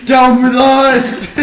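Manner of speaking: drawn-out rallying roar; shouted rally. Acted. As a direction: bellow it like a mob.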